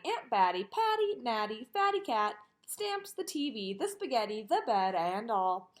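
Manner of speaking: usual speaking voice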